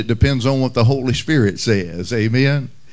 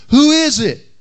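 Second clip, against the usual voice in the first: high, louder parrot squawk; 11 semitones higher, 5 dB louder than usual. Retold or imitated. Parrot calling out again, high loud squawk. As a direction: screech it like a parrot. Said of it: "the parrot again decided to utter".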